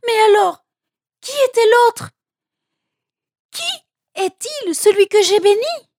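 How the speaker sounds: cri affolé, très aigu et fort; épouvante. A narrator's reading. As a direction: crie tes questions, pris de panique. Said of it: "s'écria Isaac épouvanté". (père épouvanté, voix qui s'envole)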